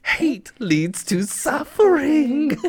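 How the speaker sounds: put-on drifting Jamaican accent, rising high; mock Jamaican accent, slowing down; accidental Jamaican lilt; Jamaican-ish accent. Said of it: "Was that Jamaican at one point?".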